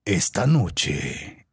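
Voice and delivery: deep slow trailer announcer; low, drawn-out epic voiceover; movie trailer voice. Shown as a demonstration. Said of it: "Así como trailer de película".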